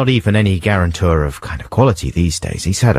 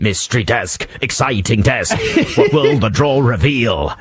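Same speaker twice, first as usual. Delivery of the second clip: mock trailer voice; 2 semitones higher than usual. Jokey trailer voice for desk.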